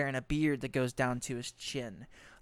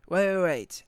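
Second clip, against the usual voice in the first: ordinary talk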